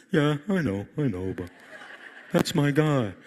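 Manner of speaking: God voice. Acted.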